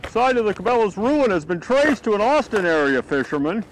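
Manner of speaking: high, loud announcer voice; mock news anchor. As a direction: read it like a breaking TV news report. Mock news report about himself.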